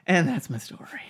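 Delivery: hushed close; whispered sign-off. Real, not acted. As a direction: whisper it as a wrap-up. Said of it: "ending the story".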